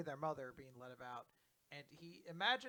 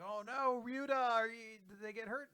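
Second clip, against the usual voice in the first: worried cry; 7 semitones higher, 9 dB louder than usual. Retold or imitated. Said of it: "they're like".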